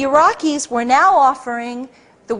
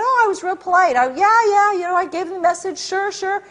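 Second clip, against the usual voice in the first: breezy; 7 semitones higher than usual. Retold or imitated. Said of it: "I said".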